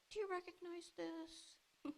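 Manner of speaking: lightly voiced messenger, feigning innocence; softly held-out question; sly messenger. Messenger shows bloody coat.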